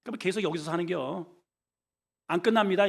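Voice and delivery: normal talking voice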